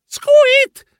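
very high, loud squeak; startled squeal. Acted. Narrator struck by the flying ghost, startled squeal. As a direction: squeal on being hit.

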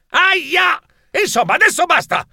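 high, exasperated yelling protest; fed-up mouse shouting. Acted. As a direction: snap at the pushy seamstresses. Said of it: "strillai esasperato".

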